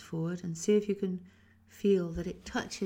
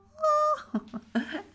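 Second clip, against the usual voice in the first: laugh; 9 dB louder than usual. Real, not acted.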